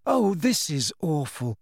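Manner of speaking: slow, softened near-tears sigh; upset girl close to tears; tearful. Acted. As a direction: let the words sag with sorrow. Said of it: "who looked tearful".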